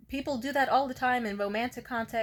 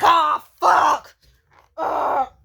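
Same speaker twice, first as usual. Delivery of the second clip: higher, much louder tantrum yell; enraged outburst; 5 semitones higher, 13 dB louder, much slower than usual. Retold or imitated. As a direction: play the boyfriend losing it at the news. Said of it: "he immediately was like".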